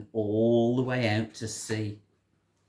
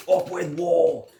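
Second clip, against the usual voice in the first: louder, slow battle shout; 6 semitones higher, 7 dB louder, slower than usual. A narrator's reading. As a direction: shout it out slowly. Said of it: "And they shouted".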